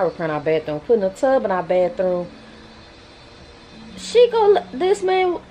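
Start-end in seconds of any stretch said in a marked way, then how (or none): 4.0-5.4 high, slow exasperated whine; high, slow exasperated complaint about his wife. Real, not acted. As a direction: stretch it out in disbelief.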